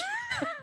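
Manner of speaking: high squealing laugh burst; a delighted squeal of laughter; shrieking laugh. Real, not acted. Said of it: "Which I honestly thought was fire".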